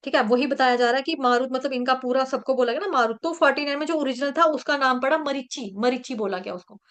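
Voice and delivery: usual speaking voice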